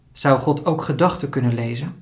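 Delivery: usual speaking voice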